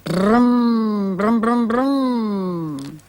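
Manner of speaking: droning brum brum engine sound; childlike vroom of a car engine; car noise. Acted. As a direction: rumble like a car driving by. Imitating a passing car.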